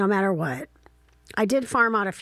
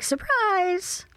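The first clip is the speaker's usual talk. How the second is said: a high, sing-song mock "Surprise"; 12 semitones higher than usual. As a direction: mimic the parents' gleeful taunt. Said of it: "and then be like".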